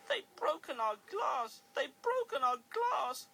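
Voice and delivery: high, loud, slow wail; sobbing. Acted. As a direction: wail over the broken glass. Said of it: "sobbed the coaches".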